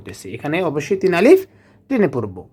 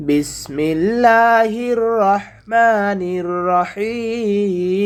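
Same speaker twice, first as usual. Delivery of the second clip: drawn-out chanted Arabic, slow and melodic; slow Quran recitation; 3 semitones higher, much slower than usual. Shown as a demonstration. Teacher opening the lesson, slow melodic recitation. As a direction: intone it slowly like tilawat.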